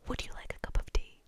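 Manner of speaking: airy, voiceless polite offer; breathless. A narrator's reading. Percy offering his boss a cup of tea, breathlessly. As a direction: offer it on nothing but breath. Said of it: "said Percy breathlessly".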